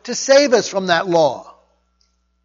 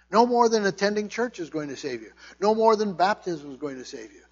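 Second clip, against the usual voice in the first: normal talking voice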